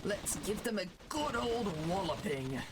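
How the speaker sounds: hammed-up jolly old British officer; British gentleman officer. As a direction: address the troops like a genteel British commander. Plays British officer in game.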